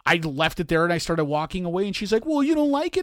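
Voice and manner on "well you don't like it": hurt daughter. Retold or imitated.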